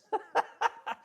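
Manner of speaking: short high amused laugh; high burst of laughter. Real, not acted. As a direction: laugh out at how cheap it used to be. Laughs at old oil price.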